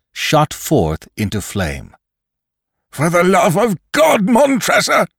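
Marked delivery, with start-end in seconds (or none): 2.9-5.1 much higher and louder, a pleading shriek; terrified scream from inside the burning coffin; desperate scream. Acted. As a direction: scream for mercy from the flames.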